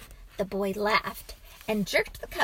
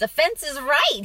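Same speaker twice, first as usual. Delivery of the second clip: higher, louder surprised approval; pleasantly surprised; 5 semitones higher, 6 dB louder than usual. A narrator's reading. Father praising son's correct guess, surprised. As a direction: confirm it with raised, surprised pitch. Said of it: "The man was surprised".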